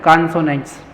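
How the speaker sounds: कॉन्सोनेंट्स starting with open "kaan"; correct pronunciation. Shown as a demonstration. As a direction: repeat कॉन्सोनेंट्स as "KAAN-so-nents" for pupils. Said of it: "क्या होता है?".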